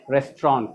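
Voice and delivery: RESTAURANT as a low, falling res-tron; correct pronunciation. Shown as a demonstration. Teacher confirms the answer for the class, रेस्टोरेंट as "res-tron". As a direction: say रेस्टोरेंट without the final T. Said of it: "correct".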